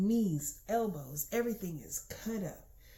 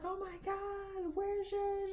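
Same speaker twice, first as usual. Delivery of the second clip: very high, slow mock-teacher voice; imitating flustered teachers; 11 semitones higher, slower than usual. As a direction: play a fussing teacher in a high-pitched dither. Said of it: "So they were like".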